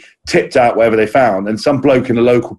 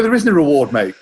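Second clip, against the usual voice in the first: ordinary delivery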